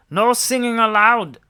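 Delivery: loud, stern constable bark; shouted order. A narrator's reading. Constable stopping the singers, loud. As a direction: forbid it like a strict policeman. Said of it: "shouted Constable Jansen".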